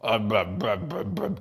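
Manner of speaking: low, lifeless droning blah blah; a bored zombie mumbling blah, fading to almost nothing; mock dead droning. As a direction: drone it like someone who has checked out. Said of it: "They're just like".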